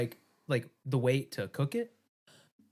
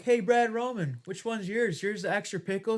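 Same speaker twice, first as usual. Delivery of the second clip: mock sweet; 8 semitones higher than usual. Retelling taunting a coworker.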